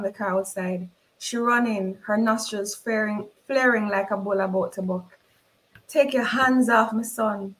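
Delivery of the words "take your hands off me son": ordinary delivery, nothing marked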